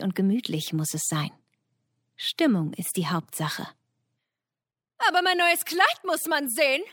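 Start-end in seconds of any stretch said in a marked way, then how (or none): 5.1-6.8 high, vain peacock. Acted. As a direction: preen it like a showy peacock. Pfau spricht.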